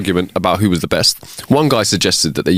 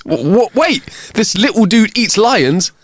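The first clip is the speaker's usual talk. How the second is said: high-pitched, slow disbelief; stammering shock; 11 semitones higher than usual. Acted. Lion halted by the dog's boast, stammering in high disbelief. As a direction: stutter to a halt, then question it high.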